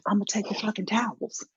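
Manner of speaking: whispered brazen confession; mock whisper. Hotel guest telling the desk his plan, mock whisper. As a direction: whisper it as the proud towel thief.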